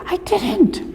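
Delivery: put-on a kid insisting he didn't; whiny child. Child whining a denial to parent.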